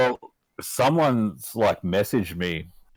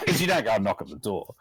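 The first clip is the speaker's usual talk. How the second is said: ordinary talk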